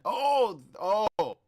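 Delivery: loud, high oh oh oh; loud startled exclamation; shocked outcry. Real, not acted. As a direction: blurt it out in disbelief. Reacting to the shocking report.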